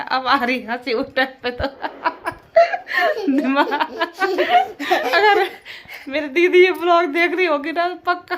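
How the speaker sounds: speaking through loud, high laughter; real helpless laughter while talking; laughing. Real, not acted. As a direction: let the laugh break through the words. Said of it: "फिर हम इतना हंस आ रही ना".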